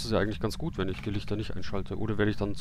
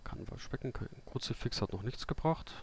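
ordinary delivery

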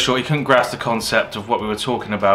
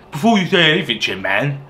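silly mock voice; 4 semitones higher than usual. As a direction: repeat it as a goofy, mush-mouthed imitation. Repeats quote in silly voice.

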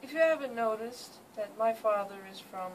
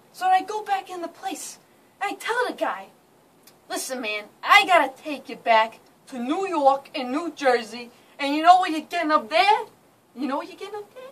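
brash New Jersey accent; thick New York and Jersey street accent; 4 semitones higher, 5 dB louder than usual. Shown as a demonstration. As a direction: talk like a guy from New Jersey. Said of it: "I'm going to demonstrate his accent".

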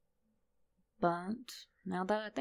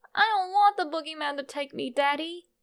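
put-on higher, louder, fast pleading boy; scared little son; 9 semitones higher, 5 dB louder than usual. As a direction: beg like a frightened little boy.

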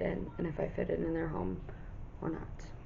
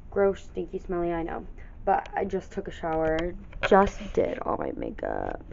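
ordinary delivery

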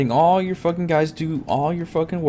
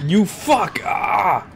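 angry yelp; 6 semitones higher, 3 dB louder, much slower than usual. Real, not acted. Getting shot, yelling.

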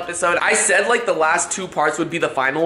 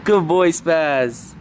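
soothing pet voice; 7 semitones higher than usual. Real, not acted. Vlogger calming his friend's nervous dog, high coaxing pet talk. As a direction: coax the dog gently in a higher voice.

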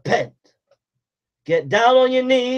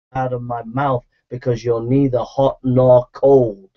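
voice of God; 11 semitones lower than usual. Retold or imitated. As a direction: speak as God passing judgment. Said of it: "God says to you".

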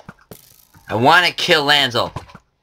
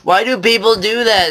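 much higher exasperated complaint; 10 semitones higher than usual. Real, not acted. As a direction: whine in disbelief. Annoyed at other players.

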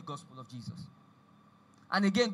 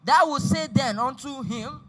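forceful, raised-voice Bible reading; scripture read aloud; 3 semitones higher, 5 dB louder than usual. Acted. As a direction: declaim the passage, driving the key words. Reads scripture aloud to congregation.

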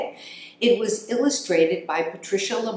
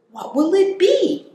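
much higher, louder curious squeak; wondering hamster voice; 14 semitones higher, 6 dB louder than usual. Acted. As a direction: ask it like a puzzled tiny hamster.

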